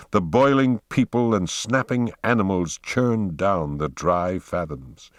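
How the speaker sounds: usual speaking voice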